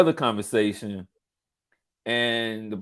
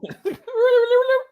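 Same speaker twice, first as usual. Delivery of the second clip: high squeaky tape rewinding sound; 4 dB louder than usual. Acted. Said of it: "that's the rewind voice".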